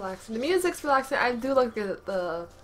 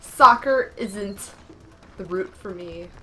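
dramatic declaration; 12 dB louder, slower than usual. Real, not acted. Dramatic resignation.

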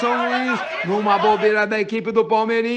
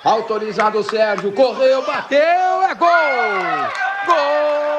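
football commentary building to a goal shout; live play-by-play. Acted. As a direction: call the attack rising to GOL.

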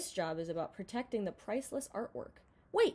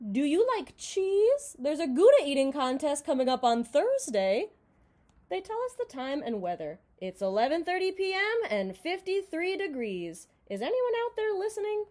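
radio DJ; 5 semitones higher, 6 dB louder than usual. Acted. Late night radio DJ on air.

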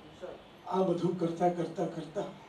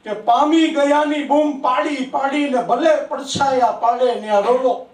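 raised, sing-song reciting voice; poetry recitation; 7 semitones higher, 9 dB louder than usual. Acted. Poet reciting his verse to an applauding audience, raised and sing-song. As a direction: declaim each line to the hall, savouring it.

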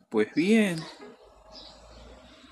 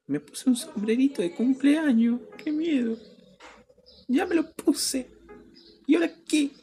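mock scared. Mock-frightened at party hat.

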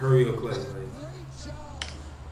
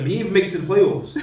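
ordinary talk, nothing marked

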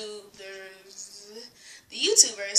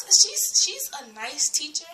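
ordinary talk, nothing marked

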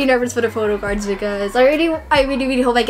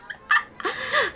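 giggle; 11 semitones higher, 6 dB quieter than usual. Real, not acted. Giggling at her first bias.

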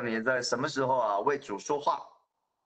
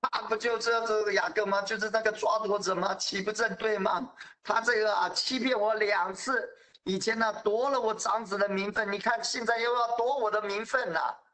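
higher, indignant complaint; aggrieved Esau; 8 semitones higher than usual. Acted. Esau complains to his father that Jacob cheated him, indignant. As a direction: protest being cheated twice.

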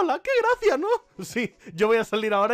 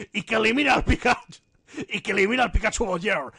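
solemn island elder. Pretend. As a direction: intone a prayer to a guardian spirit.

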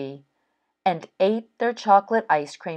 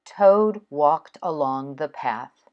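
plain reading voice

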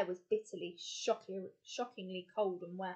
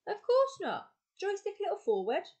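much higher, loud call; 12 semitones higher, 7 dB louder than usual. A narrator's reading. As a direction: call it over the roaring engine. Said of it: "called the explorer".

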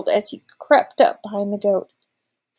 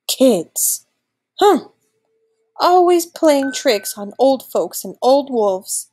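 put-on grumpy old wolf's complaint; groaning; 3 semitones higher, slower than usual. A narrator's reading. Old wolf complaining about trickster kids, groaning. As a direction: grumble it out slowly, with a huff. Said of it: "he groaned as he soaked his tired feet".

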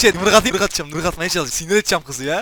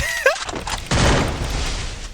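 laughing. Real, not acted. Laughs at chaotic game moment.

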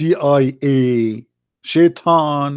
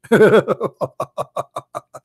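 a burst of real laughter; 4 semitones higher than usual. Real, not acted. Laughing at the text.

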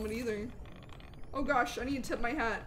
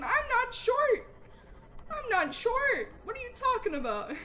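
playfully offended, pitched-up; indignant protest; 10 semitones higher than usual. Real, not acted. Protests teasing from a chatter.

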